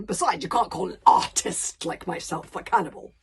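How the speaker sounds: a touch of exasperation in the monster voice; lightly offended giant; mildly exasperated dragon. A narrator's reading. Dragon mildly offended at being called a cannibal. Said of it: "He sounded a bit exasperated now".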